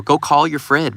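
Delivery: usual speaking voice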